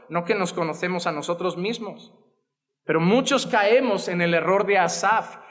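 ordinary talk, nothing marked

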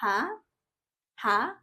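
"Ha?" rising like a puzzled question; Mandarin second tone. Shown as a demonstration. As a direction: ask "Ha?" as if you didn't hear. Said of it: "para ka lang nag-a-ask ng question".

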